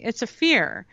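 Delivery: ordinary talk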